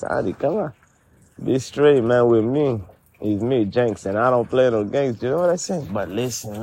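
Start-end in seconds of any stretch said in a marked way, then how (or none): none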